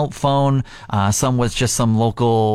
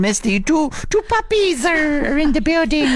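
puppy voice. Real, not acted. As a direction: coo it like you're talking to puppies. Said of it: "I get my puppy voice on".

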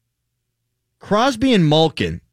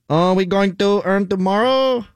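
put-on French accent; 2 semitones higher than usual. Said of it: "I could do Giroux".

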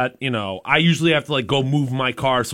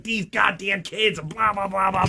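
grumbling; 6 semitones higher, 4 dB quieter, slower than usual. Retold or imitated. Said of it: "I was so effing angry".

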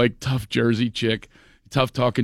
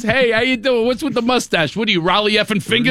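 higher, brash Jersey friend; mock brash friend; 9 semitones higher than usual. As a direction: friend teasing a famous pitcher to his face. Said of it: "She goes".

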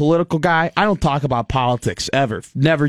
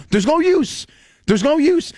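high-pitched exasperated outburst; exasperated rant; 12 semitones higher than usual. Real, not acted. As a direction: throw your hands up, pitch way up. Rant peak.

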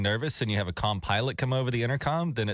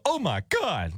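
higher-pitched, over-excited doctor; all excited; 6 semitones higher, slower than usual. Acted. Co-host imagining a doctor walking in all excited. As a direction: burst out in mock excitement. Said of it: "like, all excited about something".

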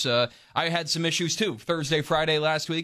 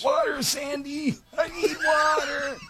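high, dragged-out hammed-up plea for water, shouting; mock parched cry; 12 semitones higher, slower than usual. Joking to cohost, hammed-up parched cry for water. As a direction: wail like you're dying of thirst.

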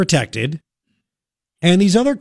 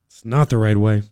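"It's not the right way" dropped much lower, weight on "not"; lower, firmer repeat; 7 semitones lower than usual. Shown as a demonstration. Host rebuking teachers over pay, repeating it lower and firmer. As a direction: say it again lower and flatly final, leaning on "not".

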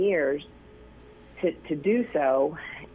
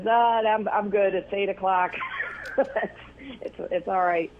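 put-on Sean Connery Scottish accent; 4 semitones higher than usual. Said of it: "his Scottish accent".

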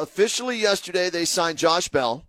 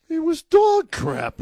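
scornful; 11 semitones higher, 3 dB louder, slower than usual. Real, not acted. Scornful verdict on catcher.

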